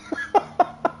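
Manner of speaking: breathless squeaky giggle; a giddy voiceless high squeal; high squeal of laughter. Real, not acted. Giddy about the PlayStation Showcase, high breathless squeal.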